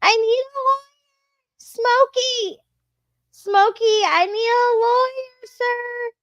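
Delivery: put-on high, childlike lisping plea. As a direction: pitch way up, small pleading girl voice.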